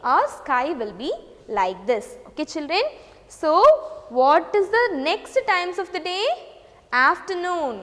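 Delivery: ordinary talk, nothing marked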